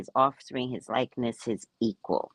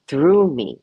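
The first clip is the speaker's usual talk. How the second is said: normal talking voice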